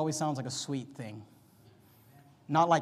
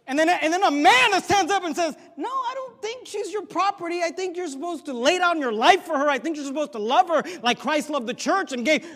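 much higher, shouted preaching voice; fiery preaching; 11 semitones higher, 7 dB louder than usual. Acted. Preacher shouting down rival preachers to the congregation. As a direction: blast it from the pulpit.

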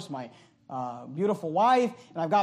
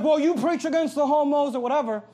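retold loud talk; 5 semitones higher, 4 dB louder than usual. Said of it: "And he's talking really loud".

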